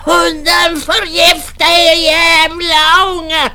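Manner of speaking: put-on high, slow cursing old-man voice; angry old man. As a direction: spit the curse at the kids. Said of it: "han svarade alltid".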